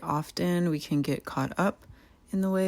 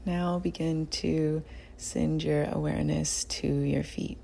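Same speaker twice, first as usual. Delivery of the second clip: guided meditation; 6 dB quieter than usual. Real, not acted. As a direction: guide them gently through the body, unhurried. Leads a body scan meditation.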